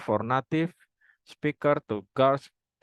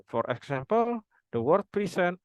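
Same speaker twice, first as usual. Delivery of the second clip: ordinary delivery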